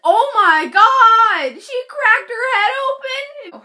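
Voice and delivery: slow, high, loud gasp of disbelief, half-crying; shocked mom. Retold or imitated. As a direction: stretch it out like a horrified mom. Mom hearing about cracked head.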